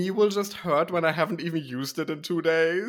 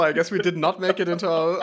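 ordinary delivery

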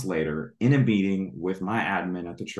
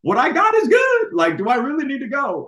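reluctant whining; 9 dB louder than usual. Retold or imitated. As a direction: whine like a kid who doesn't want to leave. Retold reluctant answer to God.

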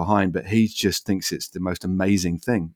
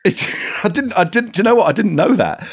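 laughing surprise; 11 semitones higher than usual. Real, not acted. Surprised by the news.

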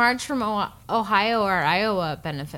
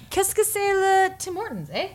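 put-on French Canadian accent; 10 semitones higher than usual. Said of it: "I speak a little French, so I could be like".

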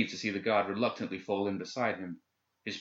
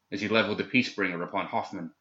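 ordinary delivery, nothing marked

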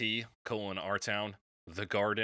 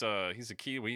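usual speaking voice

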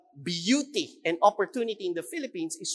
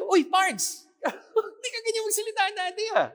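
laughing teasing quote. Retold or imitated.

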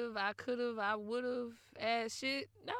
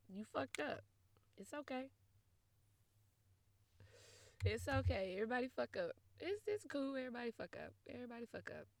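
mock soothing fade; 10 dB quieter, faster than usual. Mock comfort trailing off.

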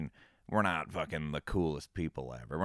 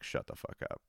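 breathless whispered disbelief; stunned whisper; 6 dB quieter than usual. Retold or imitated. Hearing his girlfriend read the award email, whispered shock. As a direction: whisper it, floored by the news.

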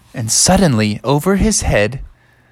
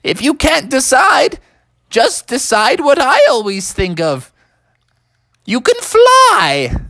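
wizard proclaiming the magic; triumphant wizard; 9 semitones higher, 3 dB louder than usual. Acted. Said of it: "And the wizard said".

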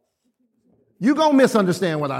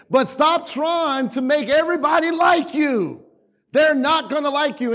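preaching shout; 5 semitones higher than usual. Real, not acted. Preacher exhorting the congregation.

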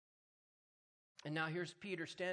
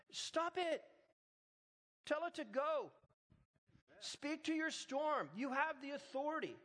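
higher, slow emphatic preaching; full-force preaching; 10 semitones higher than usual. Acted. Pastor urges the congregation to command their problems, fervent preaching. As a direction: drive it home to the crowd.

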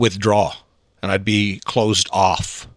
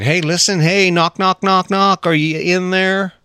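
higher-pitched, insistent knock-knock call; trying to get through; 7 semitones higher than usual. Acted. Podcaster to unreachable listeners, insistent calling. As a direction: call out as if knocking on someone's head. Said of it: "how do I get through to 'em".

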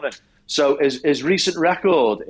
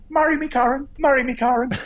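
imitating the boxer; 11 semitones higher than usual. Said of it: "after the fight, he goes".

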